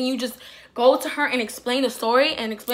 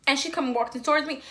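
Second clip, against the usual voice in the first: usual speaking voice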